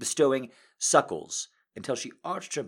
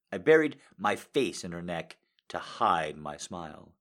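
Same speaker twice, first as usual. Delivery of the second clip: ordinary delivery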